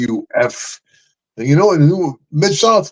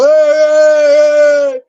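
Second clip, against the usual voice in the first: long high held yell of the crowd; crowd shout; 7 dB louder than usual. Retold or imitated. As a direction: shout it out like a whole crowd cheering. Crowd yelling at wrestlers.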